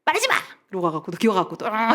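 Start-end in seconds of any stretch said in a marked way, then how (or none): 0.0-0.3 very high, abrupt outburst; mock angry cutoff. Spouse ending the quarrel, high huffy outburst. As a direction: act out refusing any more talk. Acted quarrel, cutting off talk.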